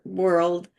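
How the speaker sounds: usual speaking voice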